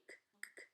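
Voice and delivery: "k" as a bare voiceless click; isolated, repeated "k"; letter sound demo. Shown as a demonstration. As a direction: say "k" as in duck, without a vowel. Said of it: "C K".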